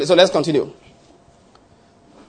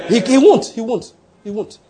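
emphatic insistence; 5 semitones higher than usual. Real, not acted. Insists, repeating himself, God won't divide.

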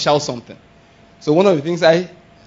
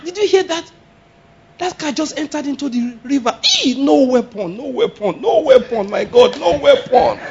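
high, rising alarm with repeated cry; high startled praise repeated; shocked exclamation; 9 semitones higher than usual. Shown as a demonstration. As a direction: react to a crash in shock. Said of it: "It's a good swear word".

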